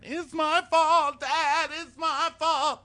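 hammed-up sobbing son; mock sob. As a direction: wail it like a weeping child. Chase sobbing in recap.

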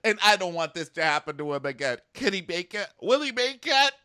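high, loud hammed-up maternal wailing; exaggerated tearful mom impression; mock sobbing mom. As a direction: imitate a mother sobbing over her son, building to a high wail. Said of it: "already sobbing on the couch for no reason".

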